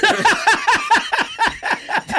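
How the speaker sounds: high, loud laugh breaking out; a real, high-pitched laughing fit; loud burst of laughter. Real, not acted. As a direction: crack up at the idea. Laughing at impossible stunt.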